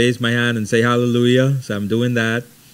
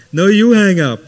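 higher and louder "No, you hang up", hamming the quarrel; playful louder insistence; 8 semitones higher, 7 dB louder than usual. Retold or imitated. Young lover insisting the other hang up, hammed-up louder tease. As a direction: push back harder, grinning. Lovers' phone goodbye back and forth.

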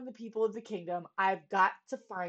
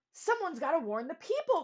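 raised, urgent warning; urgent cry; 7 semitones higher than usual. A narrator's reading. Boy urging the town to warn the people, urgent. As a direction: call out to warn everyone. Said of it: "cried Bartholomew".

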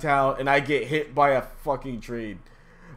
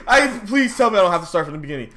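loud, fast, higher begging; desperate pleading; 8 semitones higher, 7 dB louder than usual. Real, not acted. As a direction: beg the game not to make you redo it. Begging the game not to restart.

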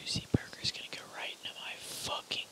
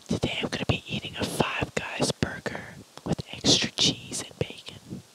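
whispering; 7 dB louder than usual. Real, not acted. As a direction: whisper close to the mic. ASMR eating video.

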